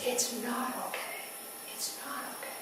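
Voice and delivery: soft broken whisper, fading out; grieving whisper. Retold or imitated. Mother tells her husband it isn't okay, fading whisper. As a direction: let the words collapse into a whisper.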